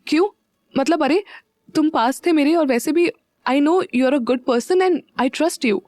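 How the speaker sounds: blurted out flustered. A narrator's reading. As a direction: caught off guard, rush to explain. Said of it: "Meera एकदम से बोल पड़ी".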